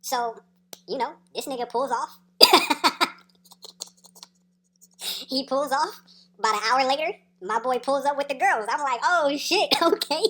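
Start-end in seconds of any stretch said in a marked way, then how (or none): 2.4-3.1 loud, high burst of laughter; helpless loud laugh; real laugh. Real, not acted. Cracks up at own story. 9.0-10.2 loud, high, laughing exclamation; gleeful retold outburst; hyped reaction. Retold reaction as girls arrive.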